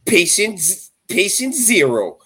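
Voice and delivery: lightly voiced gleeful cartoon guy; a hint of gleeful Quagmire. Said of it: "And he was like".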